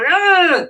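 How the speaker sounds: high mock angry ugh; acting out anger at the rig; frustrated groan. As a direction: grunt out fake frustration. Frustration after missing an obstacle.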